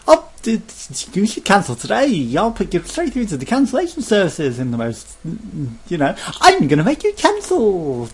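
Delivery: squeaky high, loud sales pitch; cheery pushy salesperson. Retold or imitated. Sky agent pushing cancellation, high cheery salesperson voice. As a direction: ham up an eager agent rushing him through. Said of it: "Cheery, happy".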